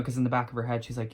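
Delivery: ordinary talk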